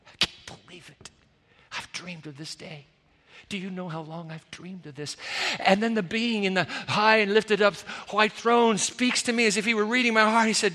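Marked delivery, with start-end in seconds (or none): 0.1-5.2 softer, half-whispered tearful disbelief; a mild hint of sobbing for joy, hushed. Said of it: "just sobbing for joy".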